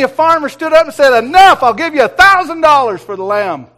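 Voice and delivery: booming storyteller climax; excited loud retelling. Retold or imitated. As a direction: belt out the farmer's big offer. Farmer bids a thousand dollars.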